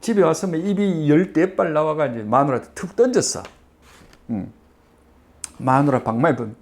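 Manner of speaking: ordinary talk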